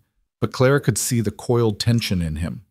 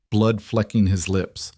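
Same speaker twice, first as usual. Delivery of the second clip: ordinary delivery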